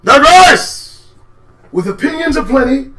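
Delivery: high, loud announcer call; loud show host jingle call; announcer intro. Acted. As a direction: hype the show name.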